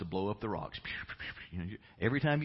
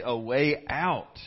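slow, louder, drawn out; call and response; 3 semitones higher, 6 dB louder, much slower than usual. Shown as a demonstration. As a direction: model the words for them to echo. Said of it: "Everybody say".

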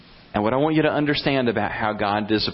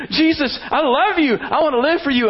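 much higher and louder fervent vow; mock fervent prayer; 4 dB louder than usual. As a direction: proclaim it like a wide-eyed believer. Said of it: "Shouldn't it be".

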